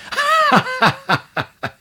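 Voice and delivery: high, shrieking witch cackle; malicious cackle. Acted. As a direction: cackle with spite. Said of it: "ехидно захохотала".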